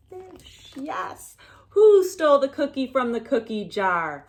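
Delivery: ordinary delivery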